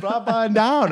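high mock of "Pop on down", falling on down; mocking chiropractor. Teasing his friend about the chiropractor, high mock voice. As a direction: echo "Pop on down" in a silly high voice.

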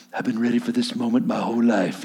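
soft, low, awed little guy; small quiet Rudy voice. Retold or imitated. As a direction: murmur it up to the captain, hushed and low. Rudy voiced in retold movie.

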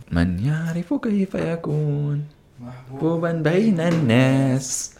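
slow, soft, high and formal recitation; recited classical Arabic. Pretend. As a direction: intone it like an old cartoon opener. Recited intro line in classical Arabic.

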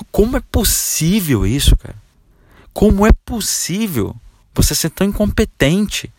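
high, slow, indignant disbelief; how on earth, incredulous and scolding; exasperated scolding. Real, not acted. Coach scolding salesman for losing a sale, exasperated.

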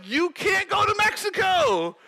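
high, hammed-up refusal; mock refusal. School administrator refuses the student a trip, mock refusal. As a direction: imitate a refusal pitched high.

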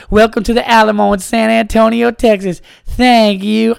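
high, loud chirpy announcer lady; tour lady voice. Retold or imitated. Comic quoting the Alamo lady to the crowd, high chirpy greeter. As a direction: sing it out like a tourist guide. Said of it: "I just hear my brother's voice go".

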